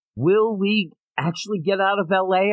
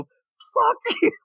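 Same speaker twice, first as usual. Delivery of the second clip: mock angry yell. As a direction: snap it out in mock fury. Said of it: "and I go".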